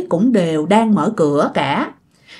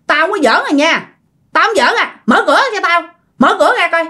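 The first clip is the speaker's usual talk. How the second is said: high-pitched, mildly annoyed demand; acted-out exasperated order; annoyed warning; 7 semitones higher, faster than usual. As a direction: call through the door that you're not playing. Trapped person calls through held door.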